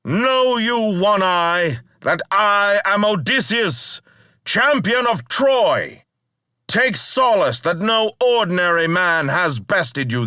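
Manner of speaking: high, loud boast hurled across the water; defiant shout. Acted. Odysseus taunting the blinded Cyclops from the ship, defiant shout. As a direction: taunt him at full voice, proud and reckless. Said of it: "I shouted".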